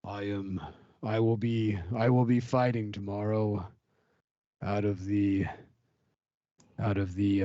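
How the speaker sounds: low, ominous warrior voice; ominous fighter voice. Acted. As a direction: brood over it like a grim gladiator. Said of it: "You sound so ominous".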